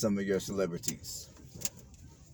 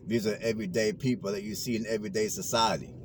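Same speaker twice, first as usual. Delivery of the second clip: normal talking voice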